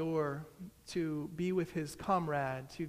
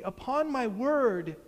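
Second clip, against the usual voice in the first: raised, exasperated exclamation; annoyed cry; 6 semitones higher, 4 dB louder, slower than usual. A narrator's reading. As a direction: cry out in annoyance, pitched high. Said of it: "cries my adversary, annoyed".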